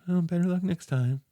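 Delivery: a hint of a kindly neighbour; kind old lady. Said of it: "and she's like".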